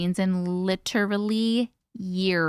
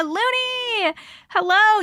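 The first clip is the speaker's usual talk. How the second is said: much higher, cried out; excited greeting; 11 semitones higher, 3 dB louder, much slower than usual. Real, not acted. Greeting a longtime team member with a gleeful cry.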